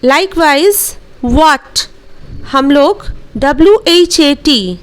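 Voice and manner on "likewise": ordinary delivery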